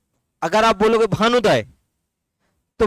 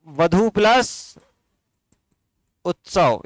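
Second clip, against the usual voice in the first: slow split demo; 2 semitones lower, slower than usual. Shown as a demonstration. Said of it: "वधुत्सव को क्या बोलेंगे?".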